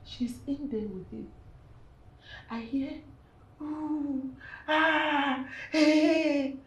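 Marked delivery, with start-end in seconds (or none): 3.6-6.6 mock moaning, drawn out and growing louder; imitated moans. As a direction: mimic overheard noises indignantly. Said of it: "That's what I'm hearing from inside the room".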